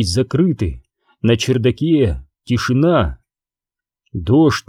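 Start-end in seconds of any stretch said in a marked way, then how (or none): none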